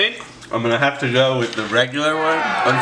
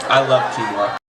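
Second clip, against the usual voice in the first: laughing. Real, not acted. Joking while laughing.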